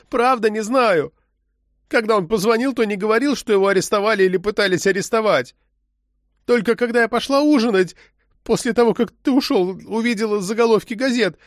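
high-pitched, plaintive protest; moaning plea. A narrator's reading. Arrested woman explains herself to the agent, moaning. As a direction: plead in a high voice to be believed. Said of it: "простонала она".

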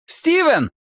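high-pitched call without much volume; crying out. A narrator's reading. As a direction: call the name out on an impulse. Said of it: "Мэтью выкрикнул". (выкрик высоким голосом)

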